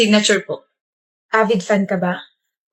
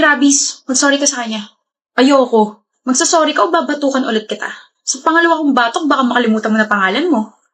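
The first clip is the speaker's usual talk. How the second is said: ordinary delivery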